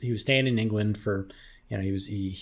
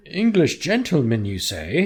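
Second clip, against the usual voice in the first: high, loud posh English gentleman voice; posh English accent; 6 semitones higher, 7 dB louder than usual. Pretend. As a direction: play up a plummy English gentleman. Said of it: "Tell me more about this English gentleman".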